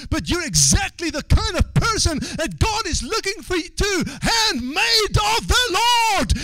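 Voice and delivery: high, loud revival shout; shouted, high-pitched exhortation to the congregation; full-force preaching. Real, not acted. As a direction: build to a soaring pulpit cry.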